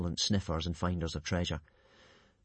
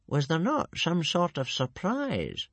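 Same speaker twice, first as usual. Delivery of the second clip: thoughtful; 8 semitones higher, slower than usual. Acted. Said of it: "asked Old Wrinkly thoughtfully".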